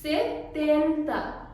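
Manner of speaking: सेटेंता said slowly with each syllable clear, se-ten-ta; slow teacher model of सेटेंता with a dental t; Spanish setenta. Shown as a demonstration. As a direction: pronounce सेटेंता carefully for learners. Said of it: "seventy को हम बोलेंगे सेटेंता".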